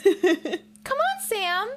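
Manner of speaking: high, bright surprised chirp; pleasantly surprised. Retold or imitated. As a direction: squeal it out, delighted and amazed. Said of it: "pleasantly surprised voice".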